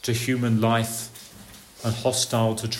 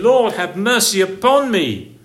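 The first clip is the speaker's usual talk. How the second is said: a mild raised plea, higher and louder; crying out; 7 semitones higher, 6 dB louder than usual. Retold or imitated. Sinner crying out to God for mercy, raised. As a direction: plead as a convicted sinner.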